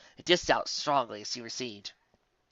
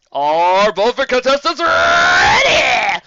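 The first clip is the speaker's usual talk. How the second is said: high, loud, slow drawn-out shout; booming call; 11 semitones higher, 13 dB louder, much slower than usual. Acted. Old Griffin calling the contestants, slow booming shout. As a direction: stretch it over the arena.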